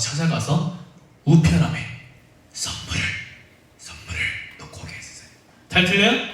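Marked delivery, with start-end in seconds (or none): 2.5-5.3 secret whisper aside; hushed conspiratorial whisper. Real, not acted. Secret plan for gifts.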